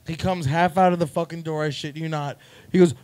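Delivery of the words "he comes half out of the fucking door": ordinary talk, nothing marked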